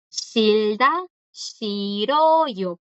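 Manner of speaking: "Shilda" rising with an L, "shiroyo" drawn out with an R; R and L demo. Shown as a demonstration. Teacher shows the conjugated verb to students, careful pronunciation demo. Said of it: "Aquí la que suena es la R".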